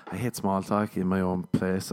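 normal talking voice

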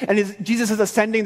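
ordinary delivery

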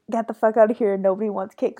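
normal talking voice

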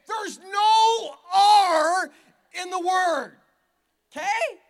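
high, loud, stretched-out cry; mock anguished shout. Pastor pleading with Mom about warsh, high drawn-out cry. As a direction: wail it like a wounded preacher.

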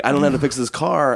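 hammed-up helpless guy at the roadside; changed voice, pushed further. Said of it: "I don't know why I changed my voice there".

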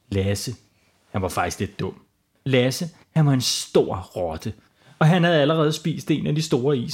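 ordinary delivery

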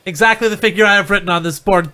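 ordinary talk

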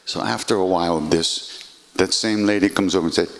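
ordinary talk

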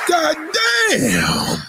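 drawn-out, high shouted goddamn; real exasperated yell. Real, not acted. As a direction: let it out in frustration. Exasperated outburst in rant.

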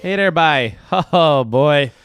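loud, hammed-up Canadian accent sliding into Irish; accidental Irish accent. Co-host hamming up Canadian politeness, slipping into an Irish accent. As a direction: put on a big jokey Irish lilt. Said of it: "Now I'm Irish for some reason".

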